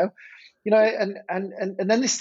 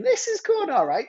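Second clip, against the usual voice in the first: delighted thought. Retold or imitated. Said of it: "I'm thinking".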